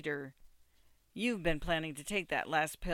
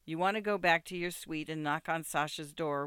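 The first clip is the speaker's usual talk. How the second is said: ordinary delivery, nothing marked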